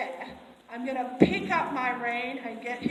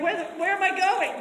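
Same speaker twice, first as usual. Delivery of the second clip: confused horse; 8 semitones higher, 8 dB louder than usual. Retold or imitated. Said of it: "he's like".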